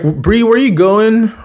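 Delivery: high-pitched puzzled call; imitated calling after. Chase asks departing Bree where she's going, imitated high call. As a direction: act out calling after someone leaving. Calls after girl storming off.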